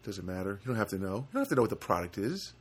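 ordinary talk, nothing marked

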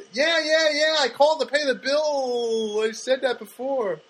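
a hammed-up fed-up customer repeating himself; a caller who is tired of being asked again; exasperated. Retold exasperated reply to bank.

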